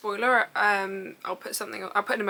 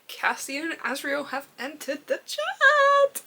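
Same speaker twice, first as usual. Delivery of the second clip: slow, higher, stretched-out squeal; thrilled announcement; 4 semitones higher, slower than usual. Real, not acted. Telling viewers favourite characters arrived, thrilled announcement. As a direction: draw it out with mounting glee.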